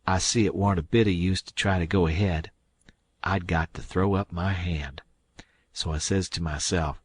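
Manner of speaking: ordinary delivery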